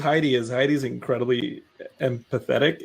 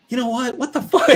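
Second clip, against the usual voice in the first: mock outburst; 8 semitones higher than usual. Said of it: "I'll be like".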